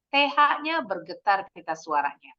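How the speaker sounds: ordinary talk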